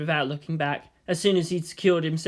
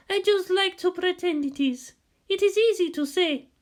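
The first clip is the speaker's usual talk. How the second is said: high, calm wise woman's voice; lightly voiced wise woman; 13 semitones higher than usual. A narrator's reading. Hasya correcting Shinji, high and calm. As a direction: gently correct him from above.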